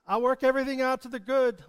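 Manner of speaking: loud, quick promise; called promise. Shown as a demonstration. Preacher calls out God's promise to be echoed. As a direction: proclaim it out boldly. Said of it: "echo his promises".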